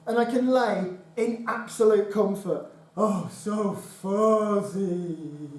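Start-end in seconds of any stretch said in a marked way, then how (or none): 3.0-5.5 blissful sigh. Acted. Lying on the old rug.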